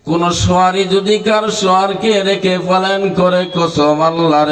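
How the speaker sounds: half-sung preaching, opening high and ringing; melodic sermon storytelling. Acted. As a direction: chant the son's impassioned answer.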